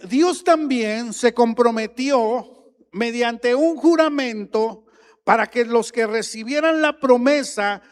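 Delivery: preaching at full force. Acted. Pastor proclaims that God swore by himself, fiery preaching. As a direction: thunder the promise to the congregation.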